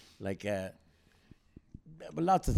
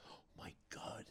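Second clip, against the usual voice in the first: breathless unvoiced oh my God; gasping whisper; 20 dB quieter than usual. The crowd seeing the crutches thrown, in a whisper.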